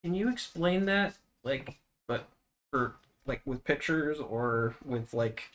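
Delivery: hushed, halting aside; soft confidential murmur, lightly acted; mock whisper. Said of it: "I'm gonna whisper".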